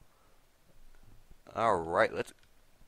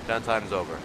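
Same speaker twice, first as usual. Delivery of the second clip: usual speaking voice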